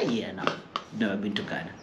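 low, soft deadpan answer; flat quiet reply. Retold or imitated. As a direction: shrug it off quietly. Her own flat reply.

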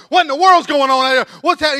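high, loud neighborly outcry; alarmed mock hollering. Neighbors come out at the gunshots, alarmed mock hollering. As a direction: holler in hammed-up disbelief.

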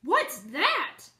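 a loud, frightened hen's cry; startled hen shrieking a question; terrified cry. A narrator's reading. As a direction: cry out in terror at something strange. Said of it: "cried in a voice of terror".

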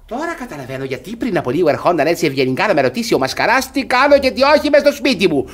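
usual speaking voice

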